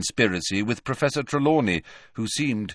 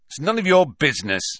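rude retort; 6 semitones higher, 5 dB louder, faster than usual. A narrator's reading. Malfoy snaps.

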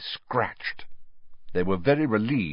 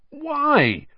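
high-pitched impatient demand; impatient, swooping question; 4 dB louder than usual. A narrator's reading. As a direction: press impatiently for an answer. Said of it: "said George impatiently".

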